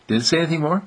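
ordinary delivery

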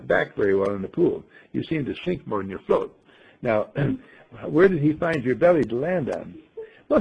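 ordinary talk

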